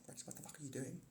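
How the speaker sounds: hushed unvoiced whisper; scolding yourself in a whisper. Whispered self-scolding quote.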